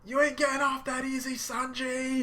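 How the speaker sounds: high, loud challenge; defiant yell. Retold or imitated. Luffy shouting his challenge at Sanji. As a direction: shout it straight at him. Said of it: "He's like".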